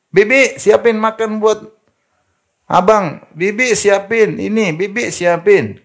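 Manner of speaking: high, slow, lordly orders to the maid; hammed-up lady of the house ordering around; bossy rich wife. Rich wife bossing the maid, mocking high slow orders. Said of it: "pembantunya suruh".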